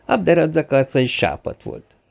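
plain reading voice

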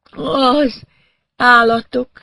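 high, loud, halting; stunned, pitched far up; woman in shock. A narrator's reading. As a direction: force out each word in a daze. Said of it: "rendes sokkot kapott odakint".

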